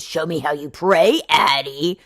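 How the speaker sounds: mock commanding lover. Hero commanding, far timbre.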